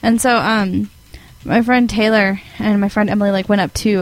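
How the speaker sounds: ordinary delivery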